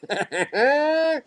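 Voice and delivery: high-pitched chuckle; short, high burst of laughter. Real, not acted. Laughs after repeating the name.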